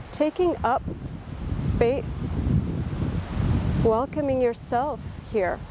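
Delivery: unhurried, calm body-scan voice; guided meditation. Real, not acted. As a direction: guide them gently through a body scan, leaving space between phrases.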